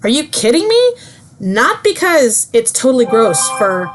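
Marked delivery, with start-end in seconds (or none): 0.0-0.9 higher, mock-shocked disbelief; incredulous. Girlfriend scoffing at her boyfriend, mock incredulous. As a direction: toss it off like an outraged retort. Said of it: "And she's like".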